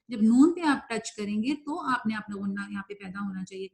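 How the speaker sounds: usual speaking voice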